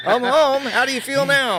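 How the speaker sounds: high, loud playful greeting; hammed-up happy arrival; mock homecoming. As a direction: call it out like a husband walking in. Acting coming home.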